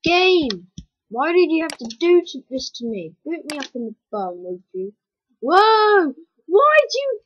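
loud, high outraged protest; loud cry of outrage at the game. Real, not acted. Game ruins the run again.

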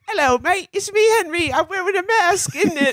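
high, cutesy British bear voice; teddy bear Henry. Retold or imitated. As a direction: chirp it like a friendly toy bear. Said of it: "and he was like".

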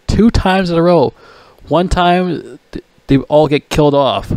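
ordinary delivery

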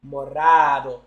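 Morado chanted low and firm with a tapped r; Spanish morado. Shown as a demonstration. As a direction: lead the chat in a chant of Morado. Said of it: "Say it with me".

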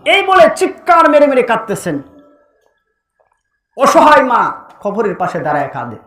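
loud, high-pitched storytelling chant; impassioned sermon narration. A narrator's reading. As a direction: project the tragic tale with preacher's force.

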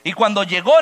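ordinary delivery

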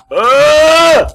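sudden high scream; startled scream. Real, not acted. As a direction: scream in fright. Jump scare in horror game.

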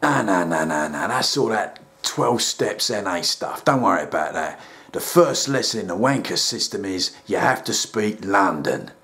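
a deep, calm therapist talking down; deep soothing counsellor; rehab counsellor. Acted.

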